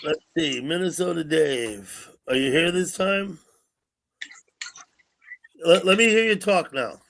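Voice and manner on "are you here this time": normal talking voice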